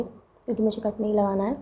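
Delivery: usual speaking voice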